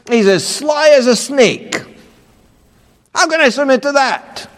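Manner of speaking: high, aggrieved wife's protest; complaining wife. Retold or imitated. Preacher imitates a wife excusing herself, high aggrieved whine. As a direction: whine out every grievance about him. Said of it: "They say".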